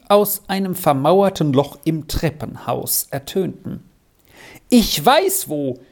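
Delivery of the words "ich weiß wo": high, stretched-out repeated call. A narrator's reading. Frau Suse calls while coming down the stairs, high and urgent. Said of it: "rief Frau Suse".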